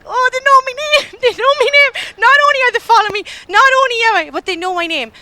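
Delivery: comic panic at hearing her name called; panicked. Retold or imitated. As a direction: squeal it as if caught by strangers. Panics that strangers know her name.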